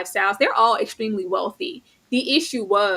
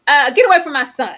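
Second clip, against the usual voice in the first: louder, higher yell of get away from my son; mock mother's yell; 5 semitones higher, 9 dB louder than usual. Imitating the mother ordering her son's lover away, loud. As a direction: bark it like an outraged mother. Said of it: "mother character quoted".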